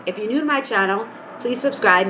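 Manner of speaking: normal talking voice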